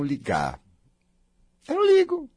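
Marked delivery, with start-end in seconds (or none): 1.7-2.3 high airy carefree voice. Pretend. Speaker to herself, breezy carefree. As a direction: toss it off lightly, high. Acting out carefree self-talk.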